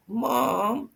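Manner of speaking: high, pleading "Mom"; a child begging to keep the medal; whiny kid. Retold or imitated. Kid pleading with his mom to keep the medal, whiny plea. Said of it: "he's like".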